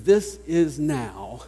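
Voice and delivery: slow, drawn-out "This is now" with a rising lift; slow and wondering on "This is now"; exclamatory emphasis. Shown as a demonstration. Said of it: "needs an exclamation point after it".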